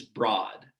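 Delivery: "broad" with an open, low vowel; own dialect pronunciation. Shown as a demonstration. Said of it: "I say it like this".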